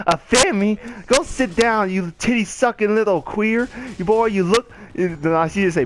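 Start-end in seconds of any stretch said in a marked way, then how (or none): 0.1-4.7 raised-pitch roasting; mock scolding. Woman on the bus roasting the man, scolding. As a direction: imitate a woman tearing into someone.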